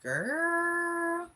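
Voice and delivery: put-on high, loud drawn-out Philly "Girl". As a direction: stretch "Girl" out like a Philly auntie.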